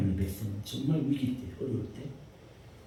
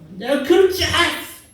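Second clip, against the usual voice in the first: high, loud mock yell in a crisis; mock shout; 8 dB louder than usual. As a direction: shout it like a panicking person. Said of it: "막 소리를 막 지르잖아요". (위기에 소리 지르는 사람 흉내, 높고 큰 외침)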